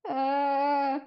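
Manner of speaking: high, nervous, laughing repeat; nervous echo. Retold or imitated. Teen caught at home by mom, nervous laughing repeat. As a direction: stall by repeating her question. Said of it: "and I'm like".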